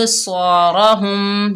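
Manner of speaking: स्वारहुम drawn out slowly, heavy स्वाद; recited word. Shown as a demonstration. Teacher pointing out the double sound to students, slow heavy recitation. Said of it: "देखिए double sound है".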